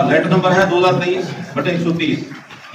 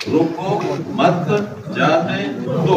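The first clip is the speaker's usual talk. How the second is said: spaced out, one word at a time; much slower than usual. Shown as a demonstration. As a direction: lay out each word separately with pauses. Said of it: "चार उसके शब्द हैं".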